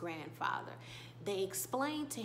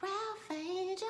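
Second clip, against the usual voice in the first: high, drawn-out fangirl cry; swooning squeal; 9 semitones higher, slower than usual. Real, not acted. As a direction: sigh out the name in a long high wail. Swoons at Ralph Angel's line.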